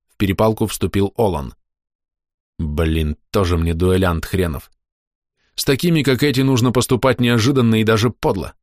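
usual speaking voice

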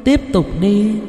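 high, echoing phantom voice; eerie urging spirit. A narrator's reading. Ghost urges Lợi on toward hanging, high eerie praise. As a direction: coax with long pauses between phrases.

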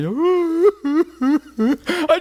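long high comic sob; hammed-up high wailing "ooh"; mock crying wail. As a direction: burst into exaggerated boo-hooing. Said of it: "just start crying".

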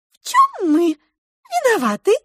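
very high, squeaky question; tiny mouse asking innocently; polite pink mouse. A narrator's reading. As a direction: pipe it up politely, like a little mouse. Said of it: "вежливо спросила розовая мышь".

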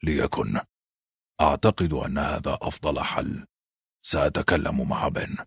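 low, soft, subdued concession; understated weary acceptance after a sigh; resigned. A narrator's reading. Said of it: "تنهد والتفت قائلا للمايستر لوين".

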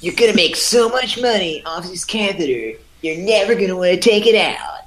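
infomercial pitchman. Pretend. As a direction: hype it like a late-night ad.

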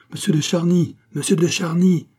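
high, urgent call. A narrator's reading. A voice calls to Charny through the opening, crying out. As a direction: cry the name out twice. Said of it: "cria une voix".

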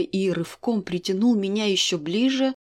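ordinary delivery, nothing marked

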